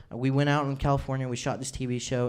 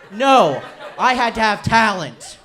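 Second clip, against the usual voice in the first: higher and louder, slow and emphatic; mock indignant; 8 semitones higher, 9 dB louder, slower than usual. Real, not acted. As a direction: insist with laughing indignation. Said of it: "emphatic indignant point".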